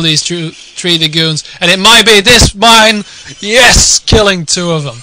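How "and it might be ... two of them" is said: excited shout. Real, not acted. Mine kills Dragoons.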